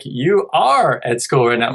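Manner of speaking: slow, stressing "are"; gentle correction. Shown as a demonstration. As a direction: lean on "are" to model the right form. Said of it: "I could be like".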